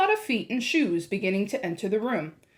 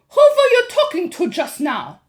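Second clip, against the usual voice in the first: sharp, loud, high witch snap; snapped; 9 semitones higher, 9 dB louder than usual. A narrator's reading. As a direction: bite the question off. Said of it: "snapped the Grand High Witch".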